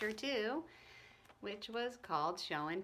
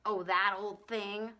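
put-on a dismissive boy's mutter, slow and unbothered; lightly voiced Howie, brushing it off; 2 semitones lower, slower than usual. Howie brushes off his mother's reminder, muttering. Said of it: "muttered Howie".

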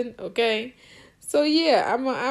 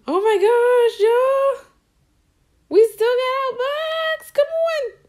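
excited squeal; 12 semitones higher, 5 dB louder, slower than usual. Real, not acted. Box still intact.

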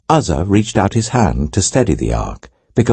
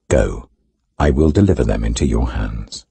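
voice of the Lord; 7 semitones lower than usual. A narrator's reading. The Lord answers David.

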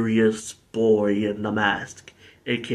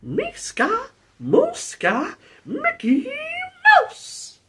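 high falsetto cartoon-mouse chant; terrible Mickey Mouse; much slower than usual. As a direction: chant it in a squeaky fake mouse voice. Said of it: "that's my terrible Mickey Mouse impression".

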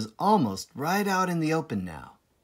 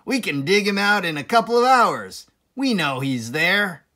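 loud, higher farmer voice, eager; Boggis; 4 semitones higher, 7 dB louder than usual. Acted. Boggis agreeing with Bean, loud eager farmer. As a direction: pounce on the idea eagerly. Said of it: "said Boggis".

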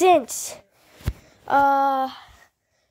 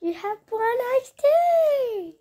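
high, slow singsong coo; pet cooing; 8 semitones higher, slower than usual. Real, not acted. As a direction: stretch it out high for your dog. Cooing to the other dog.

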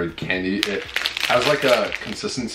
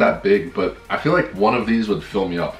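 eating a waffle; chewing while talking. Real, not acted. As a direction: mouth partly full. Seen 1: holds bitten waffle, mouth working between words; likely chewing.

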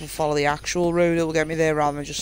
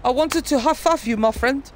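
a light put-on South African accent, higher; 7 semitones higher, 3 dB louder than usual. Gamer greets the game character in a mock South African accent. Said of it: "I went for South African there".

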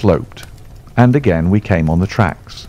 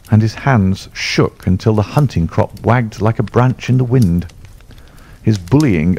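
plain reading voice

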